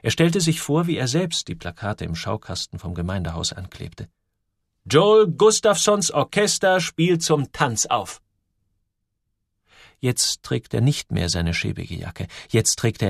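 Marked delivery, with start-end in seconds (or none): 4.8-8.2 langsame, gehobene Plakatansage; kündige das Orchester groß und gedehnt an; Ansager. A narrator's reading. Joel malt sich Plakattext aus. (feierlich ausgerufen, hoch und langsam)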